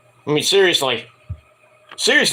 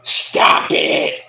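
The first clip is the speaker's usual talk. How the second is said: hoarse forced yell of stop it; exasperated shout. Pretend. Fan yelling at commentator to stop it, shouting. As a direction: yell it like you've had enough. Ranting at Booker T.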